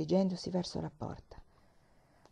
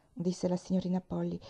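ordinary delivery, nothing marked

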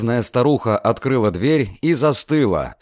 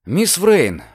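alarmed exclamation; 6 semitones higher than usual. Acted. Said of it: "воскликнул он".

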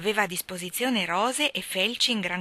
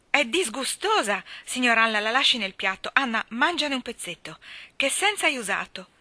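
louder, higher outraged guardian; indignant scolding; 5 semitones higher, 5 dB louder than usual. A narrator's reading. Guardian takes charge over the ruined cake, indignant. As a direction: declare disgust, then fire off orders.

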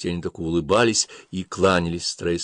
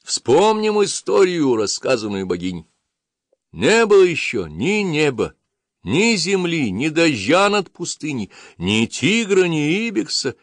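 high, slow proclaiming sermon; preaching priest; 8 semitones higher, slower than usual. Acted. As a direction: intone the legend to a crowd.

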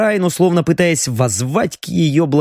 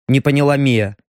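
usual speaking voice

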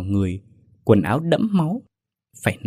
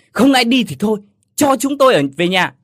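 snapping loudly, much higher and louder; angry shout; 10 semitones higher, 8 dB louder than usual. A narrator's reading. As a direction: bark it out in frustration. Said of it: "chú Ba gắt lên". (quát to đầy bực tức)

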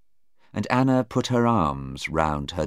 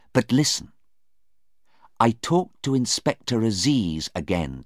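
higher, lightly urgent mother; reassuring mother; 5 semitones higher than usual. Acted. Anna comforting her daughter before the doctor comes, earnest.

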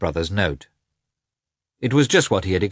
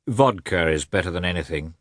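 usual speaking voice

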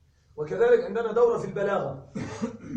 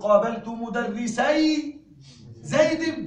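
slow and loud, زيد lifted high; slow emphatic example; slow model sentence; 4 semitones higher, 5 dB louder, much slower than usual. Shown as a demonstration. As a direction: read مدرسي زيد slowly, nun dropped. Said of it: "عند الإضافة أقول".

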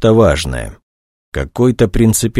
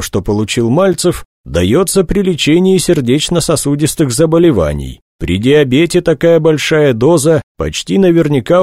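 lightly voiced colonel, higher pitch; a hint of an official reporting, pitched up; 7 semitones higher than usual. A narrator's reading. Colonel tells investigator about the drug, reporting in raised pitch.

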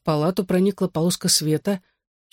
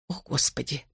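softer muttering mother; muttered dismay; 8 dB quieter than usual. A narrator's reading. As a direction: mutter it under your breath. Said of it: "пробормотала мама". (тихо пробормотать)

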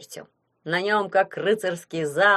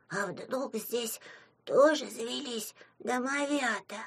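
soft, slow little imp voice; hushed wondering; 5 semitones higher, 6 dB quieter, slower than usual. A narrator's reading. Small imp suspecting others live here, hushed and slow. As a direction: wonder it slowly under your breath, childlike.

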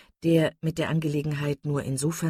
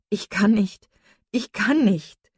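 louder, higher, desperate protest; desperate cry; 4 semitones higher, 6 dB louder, slower than usual. A narrator's reading. Marian refuses her sister's plea for composure, desperate cry. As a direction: cry out helplessly, leaning on "kann". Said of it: "rief Marian".

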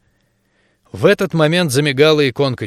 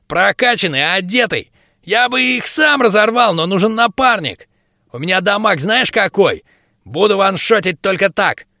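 excited gnome; 6 semitones higher than usual. A narrator's reading. Gnome boasts eagerly.

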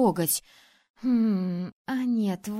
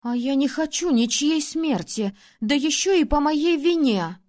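louder, higher pleading protest; 5 semitones higher, 6 dB louder than usual. Acted. Princess protests the duel loudly to the ruler. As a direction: cry out against the duel.

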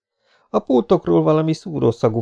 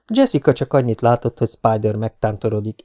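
ordinary delivery

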